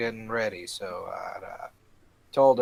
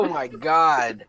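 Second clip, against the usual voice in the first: exasperated groan; 4 semitones higher, 6 dB louder, slower than usual. Real, not acted. Groaning at failed rolls.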